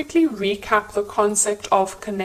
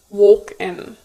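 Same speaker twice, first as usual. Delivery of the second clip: usual speaking voice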